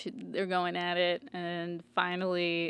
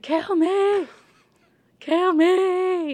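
much higher, louder mock scream; screaming plea; 12 semitones higher, 10 dB louder, much slower than usual. The dying Hound screams at Arya to kill him, a mock scream. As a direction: beg at the top of your lungs. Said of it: "screaming at her".

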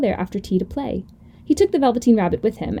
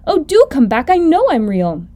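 louder, higher plea; earnest, raised-voice call after them; pleading call; 4 semitones higher, 5 dB louder than usual. Acted. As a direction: beg them to return. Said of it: "called the little rabbit".

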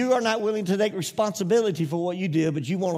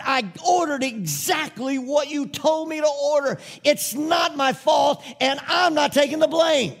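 firm, raised and higher, refusing the blame; 8 semitones higher, 4 dB louder than usual. Retold or imitated. Telling the contractor on the phone, loud and indignant. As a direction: push back hard, louder with each line.

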